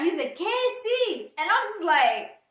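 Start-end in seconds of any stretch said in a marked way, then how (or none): 0.4-1.3 high, loud call across the restaurant; retold shout. Waiter calling her name loudly across the restaurant, a high shout. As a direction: yell the name out to get her attention. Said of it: "he screamed out".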